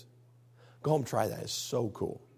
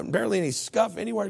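usual speaking voice

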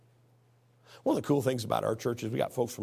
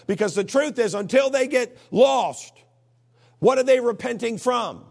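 loud, high-pitched exhortation; forceful preaching; 7 semitones higher, 6 dB louder than usual. Real, not acted. Preacher urging church to talk about sin, forceful exhortation. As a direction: drive it home, building to a stretched peak.

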